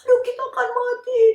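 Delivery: high, loud, tearful wail; crying in terror. Acted. Disciples thinking they will die, tearful high wail. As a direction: sob that you are going to die.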